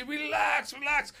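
high, loud yell of relax; shouting relax. Retold or imitated. Coach shouting relax at a fighter going too hard. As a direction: yell it at the fighter across the gym. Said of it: "I say".

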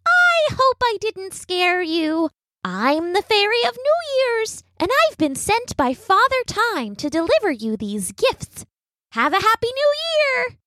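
high, sweet tiny fairy voice. A narrator's reading. The fairy speaks in the story.

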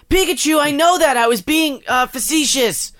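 put-on high, loud squeaky boy hero; high-pitched cartoon boy answering his pokemon; cartoon kid voice. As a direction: play Ash as a shrill cartoon kid. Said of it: "Ash is like".

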